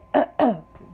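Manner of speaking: loud rough throat clearing. Real, not acted. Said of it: "This is what happens to me".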